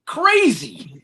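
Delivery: real exasperation, pitched high; worked up, dragging out the last words; getting mad. Real, not acted. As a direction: voice climbing in disbelief as anger builds. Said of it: "trying not to get mad".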